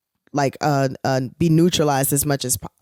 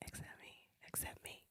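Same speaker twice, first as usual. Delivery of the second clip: fast pleading whisper; breathy playful whisper, quick; 35 dB quieter, faster than usual. Pretend. Pleading jokingly about cheese.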